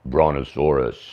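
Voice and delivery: slow, low brontosaurus voice; very deep. Pretend. Host showing off his congested dinosaur voice, very deep. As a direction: drag the word out, deep and low. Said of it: "Very deep".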